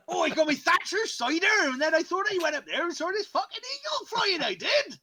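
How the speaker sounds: high, loud tipsy Cornish yokel; drunk Cornish voice. Retold or imitated. Friend riffs on the Cornish dub, drunk Cornish accent. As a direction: slur it like a pisshead at a kebab shop. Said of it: "talking like a pisshead who just walked into a kebab shop".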